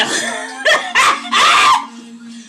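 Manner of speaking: high breathy burst of laughter; squealing laugh. Real, not acted. Vlogger laughing at her own good-girl act, high squeal.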